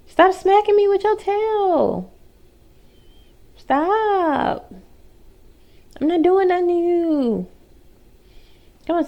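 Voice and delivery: high whiny protest. Real, not acted. Tail smacking her face, high whining protest. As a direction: whine at the dog to stop.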